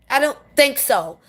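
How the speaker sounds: ordinary talk, nothing marked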